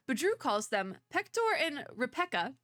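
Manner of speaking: ordinary talk, nothing marked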